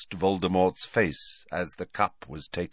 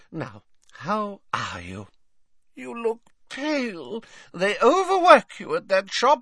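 put-on doting old lady; 4 dB louder than usual. A narrator's reading. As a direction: fuss over him like a grandmother. Old witch fussing over Tom.

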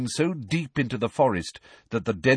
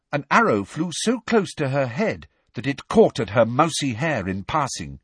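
usual speaking voice

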